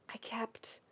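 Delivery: hushed, breathy whisper of shame; whispered confession. Acted. Keith confessing to Shiro in a whisper. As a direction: barely voice the words.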